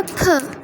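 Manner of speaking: फ as a P with a puff of air; aspirated P. Shown as a demonstration. Teacher giving the American sound of P, aspirated. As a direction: release फ as a breathy "puh". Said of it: "pronunciation पी की होती है".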